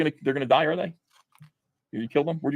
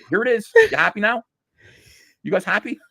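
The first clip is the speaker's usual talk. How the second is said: sarcastic; 8 semitones higher than usual. Real, not acted. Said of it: "mock sarcasm at audience".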